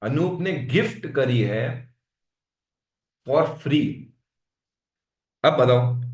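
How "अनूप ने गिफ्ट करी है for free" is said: Gift drawn out slowly with a rising tune; slow down and hit gift and for free; teacher leaning on gift करी है and for free; stress on gift and free. Shown as a demonstration. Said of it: "दो शब्दों पे ध्यान चाहूंगा".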